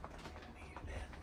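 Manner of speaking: hushed unvoiced whisper of the line. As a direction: breathe it out as a secret. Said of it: "We're gonna need an end".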